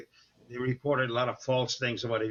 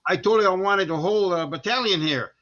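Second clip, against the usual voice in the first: mock-angry officer, louder and higher; retold angry officer; 6 semitones higher, 6 dB louder than usual. Colonel demanding his battalion from the captain, mock-angry. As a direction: imitate a colonel raising his voice.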